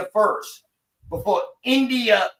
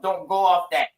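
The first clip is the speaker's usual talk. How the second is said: ordinary talk, nothing marked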